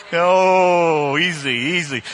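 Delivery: loud, drawn-out oh; playful loud chiding; mock reproach. Real, not acted. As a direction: draw out a loud oh to settle the crowd. Reacting to the audience.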